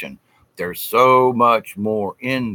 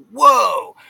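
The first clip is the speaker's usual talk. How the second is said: amazed whoa; 9 semitones higher than usual. Retold or imitated. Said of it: "They're, they're like".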